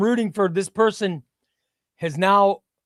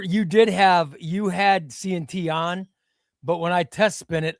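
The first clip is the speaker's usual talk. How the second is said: normal talking voice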